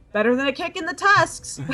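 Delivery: gruff orc quote. Acted. Quoting orc expression.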